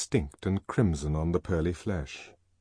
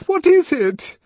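high, loud frightened cry; almost scream it at him; frightened near scream, high and loud; 9 dB louder than usual. Acted. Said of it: "she almost screamed".

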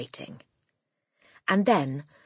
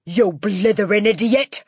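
furious, raised shout; Trunchbull; 6 semitones higher, 7 dB louder than usual. A narrator's reading. Headmistress abusing a boy who argued, furious shout. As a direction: shout the insult with relish. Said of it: "shouted the Trunchbull".

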